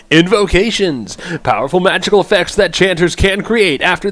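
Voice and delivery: weird announcer voice. Acted. Streamer reads chanter abilities to viewers, weird announcer voice. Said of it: "read my ability in my weird voice".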